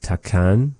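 Takan with a breathy t; aspirated t Takan. Shown as a demonstration. Teacher repeating a speaker's Takan with an aspirated t. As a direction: puff the t in Takan. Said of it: "said more with that t sound there".

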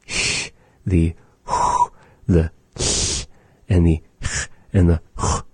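sh and h blown as pure breath; breathy sounds. Shown as a demonstration. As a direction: demonstrate sh and h with air only. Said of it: "how we use the breath".

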